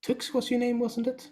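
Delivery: knowing shopkeeper. Acted. Shopkeeper recognising Tux.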